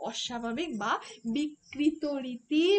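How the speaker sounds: ordinary delivery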